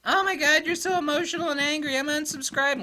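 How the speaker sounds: mock the offended subscriber in a high voice; sneering high-pitched imitation of a complainer; mocking whiny viewer. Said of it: "so serious and hardcore in that video".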